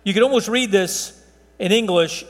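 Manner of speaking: normal talking voice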